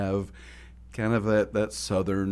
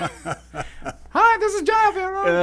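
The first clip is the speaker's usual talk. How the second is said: chipmunk voice; 7 dB louder, much slower than usual. Retold or imitated. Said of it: "more of a chipmunk's".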